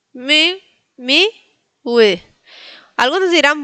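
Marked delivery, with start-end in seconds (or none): 0.1-2.2 very slow "miły", mi then ue; syllable-by-syllable Polish. Shown as a demonstration. Teacher breaking the word down for learners, slowly drawn out. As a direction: stretch miły out, ł as a w. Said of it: "la L con palito se pronuncia ue".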